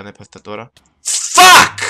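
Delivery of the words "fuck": high, very loud yell; ear-splitting swearing shout. Real, not acted. Streamer cursing at a game mishap, ear-splitting shout. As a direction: explode in one sudden shouted curse. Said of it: "Συγγνώμη για τα αυτιά σας".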